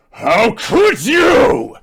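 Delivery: high, drawn-out scream at full force; loud anguished yell. Acted. Transformed boy with tears streaming yells at his enemy, loud anguished yell. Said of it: "as he yells loudly".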